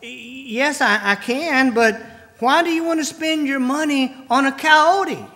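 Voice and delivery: a baffled vet, rising to disbelief; puzzled. Retold or imitated. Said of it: "very puzzled look and said".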